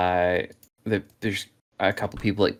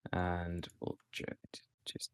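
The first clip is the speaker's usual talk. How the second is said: whispering; 2 semitones lower, 16 dB quieter than usual. Real, not acted. Whispered mid-task aside.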